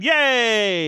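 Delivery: high, bright cheer. Pretend. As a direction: cheer like a crowd of kids. Everyone cheers.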